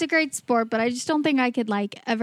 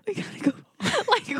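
breathy whisper, higher pitched; whispered silly voice; 9 semitones higher, 4 dB quieter, faster than usual. Retold or imitated. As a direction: whisper it like a cartoon. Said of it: "why was that the voice you chose".

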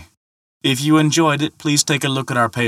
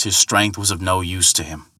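ordinary delivery

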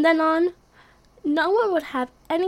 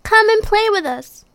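high, loud happy call; 8 semitones higher, 8 dB louder than usual. Acted. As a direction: shout it out to a friend. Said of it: "they called".

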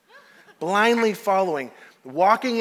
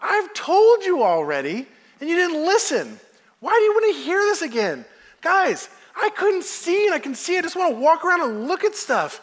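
much higher, giddy and breathless, laughing by the end; giddy healed man; 10 semitones higher, 3 dB louder than usual. Retold or imitated. Healed blind man answers the Pharisees, giddy and laughing. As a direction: bubble over like someone seeing for the first time.

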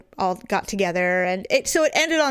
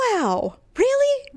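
much higher, slow stretched mock "wow"; incredulous husband; 14 semitones higher, slower than usual. Husband reacting to her moods, high slow incredulous wow. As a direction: play him stunned and fed up. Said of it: "Daryl will go".

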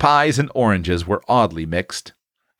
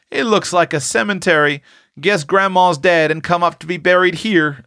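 put-on mildly solemn, higher boy's voice; 9 semitones higher than usual. A narrator's reading. Boy guesses at a funeral, lightly solemn. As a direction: voice a child's grave guess, pitched up. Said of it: "said Saul in a solemn tone".

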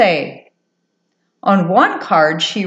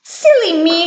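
high, tickled little mouse voice; giggly mouse; 9 semitones higher than usual. A narrator's reading. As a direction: chide yourself merrily as a little mouse. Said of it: "she giggled".

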